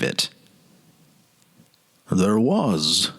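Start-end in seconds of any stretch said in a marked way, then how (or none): 2.1-3.1 lower, hushed recollection; a hint of a grown man lost in memory, pitched lower; Wallace remembering. A narrator's reading. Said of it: "he said".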